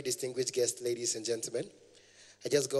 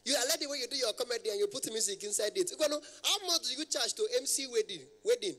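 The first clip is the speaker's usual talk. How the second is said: high, chattering mock caller; imitated high-pitched man; 9 semitones higher than usual. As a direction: keep it pitched up like an excitable caller. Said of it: "their voice is very, very high".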